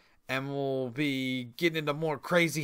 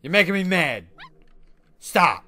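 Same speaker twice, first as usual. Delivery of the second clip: mock stern scolding, louder; mock lecture; 2 semitones higher, 7 dB louder than usual. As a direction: scold the dog half-seriously. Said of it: "I'm gonna lecture him about barking".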